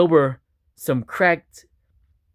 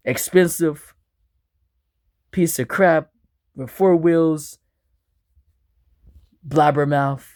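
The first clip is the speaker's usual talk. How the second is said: ordinary delivery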